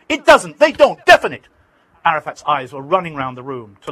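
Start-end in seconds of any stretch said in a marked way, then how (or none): 0.1-1.5 high, loud flustered denial; angry denial. Retold or imitated. Arafat angrily denying the attacks to the reporter. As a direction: cut him off with a loud denial. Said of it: "Arafat was shouting".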